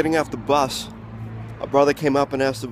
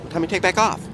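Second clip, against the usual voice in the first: repeated quick street phrasing; 4 semitones higher than usual. Retold or imitated. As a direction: insist like the brother. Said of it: "But this guy".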